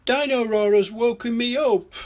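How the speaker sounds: high, loud little pig complaint; Peppa Pig. A narrator's reading. Peppa complains the toy woke her, high and loud. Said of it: "says Peppa".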